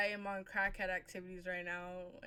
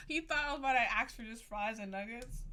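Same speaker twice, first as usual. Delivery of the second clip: ordinary delivery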